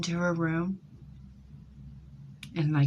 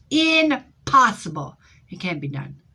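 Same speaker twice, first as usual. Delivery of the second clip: scoffing disbelief; 5 semitones higher, 7 dB louder than usual. Retold or imitated. Mocking her own disbelief.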